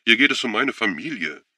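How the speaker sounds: high, loud, frantic plea; overwrought, building to a forceful finish; voice cracking. A narrator's reading. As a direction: pour it out urgently, voice tipping over. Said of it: "Seine Stimme überschlug sich".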